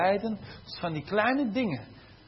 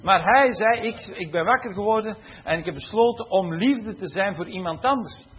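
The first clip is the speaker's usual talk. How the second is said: ordinary talk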